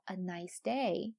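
slow, with "day" carrying the main stress. Shown as a demonstration. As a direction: lead through "nice" to a stressed "day". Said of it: "重音在day上".

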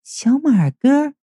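slow, high, flirty woman's voice; soft coy giggle. A narrator's reading. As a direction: draw out the name teasingly. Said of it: "轻笑道". (娇媚地轻笑着慢慢叫出名字)

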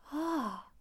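high-pitched, astonished question; sudden realization. A narrator's reading. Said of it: "王栋恍然大悟". (恍然大悟，惊讶地问)

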